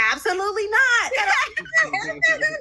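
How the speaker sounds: high, fast, hammed-up outraged shout; mock indignant. Reassuring nervous Kelly with a high mock-outraged shout. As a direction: overplay the denial to soothe her. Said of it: "taking Kendra's lead".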